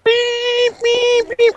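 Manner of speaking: high-pitched imitated robot beeping; robot beeps. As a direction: beep like a little dancing robot. Imitates robot dance noises.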